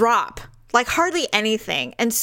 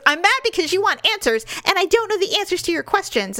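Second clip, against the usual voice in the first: high-pitched mock exasperation; mad husband; 8 semitones higher than usual. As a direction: imitate a husband venting his frustration. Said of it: "why you're mad right now".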